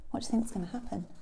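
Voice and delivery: plain reading voice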